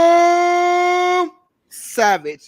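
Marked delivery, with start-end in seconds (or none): 0.0-1.3 high, slow, drawn-out hype announcement; announcer voice. Pretend. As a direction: boom out the name like a host. Host announces his show name.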